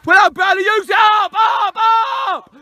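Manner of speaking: real coach's holler; urging shout. Real, not acted. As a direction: push the team forward with your voice.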